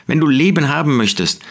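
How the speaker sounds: ordinary talk, nothing marked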